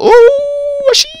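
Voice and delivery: very high, loud squeal; high-pitched delighted outburst; excited surprise. Real, not acted. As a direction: squeal at a sudden find. Spots a sheep mid-sentence.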